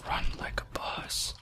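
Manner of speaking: soft breathy whisper, slow. Real, not acted. Player sneaking through the house, whispering. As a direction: hush it like you're sneaking.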